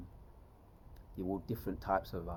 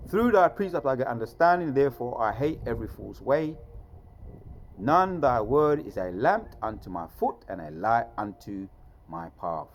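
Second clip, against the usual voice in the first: loud, raised-pitch declaiming of scripture; scripture recitation; 4 semitones higher, 7 dB louder than usual. Acted. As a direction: proclaim the verse with force.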